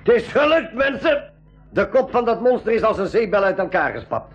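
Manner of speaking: high, triumphant cheer. Acted. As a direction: crow over the win. Cheering after killing the leader.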